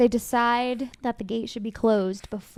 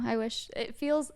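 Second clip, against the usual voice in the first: ordinary delivery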